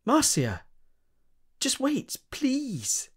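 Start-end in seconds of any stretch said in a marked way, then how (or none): 1.6-3.1 high, soft, pleading; a high-pitched, quiet plea to wait; desperate plea. Acted. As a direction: beg her to stop, voice rising and hushed. Pleading with Marcia as she packs.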